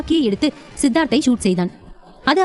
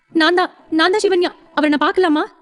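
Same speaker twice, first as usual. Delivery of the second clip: higher, urgent question; wife eagerly asking the nurse, higher; eager young wife; 6 semitones higher than usual. Acted. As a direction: rush to claim her place. Wife asking to see husband.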